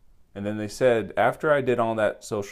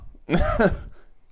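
sudden high-pitched burst of laughter; 7 dB louder than usual. Real, not acted.

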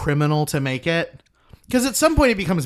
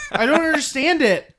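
ordinary delivery

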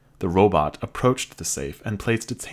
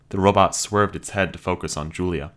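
plain reading voice

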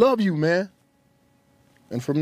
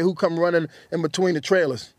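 normal talking voice

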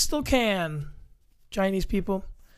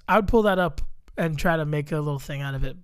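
normal talking voice

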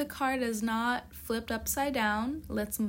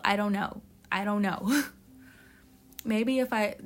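ordinary delivery

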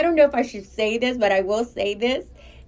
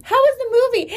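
much higher, louder sugary mom; sweet mom voice; 12 semitones higher, 5 dB louder, much faster than usual. Retold or imitated. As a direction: put on a high bright mother voice. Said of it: "his mom stands in front of us".

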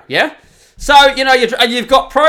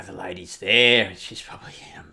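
whispered aside; 10 semitones lower, 8 dB quieter than usual. Real, not acted.

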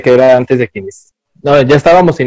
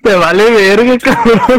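chilled laugh, high and breathless; riendo agudo; 9 semitones higher, slower than usual. Real, not acted. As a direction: laugh the words out. Risas tras insulto al amigo. (hablando entre risas)

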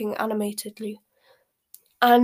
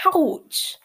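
high, loud acted ouch; yelp of pain; 7 semitones higher, 6 dB louder than usual. A narrator's reading. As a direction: yelp as if pinched hard. Hermione's hard pinch makes him yelp.

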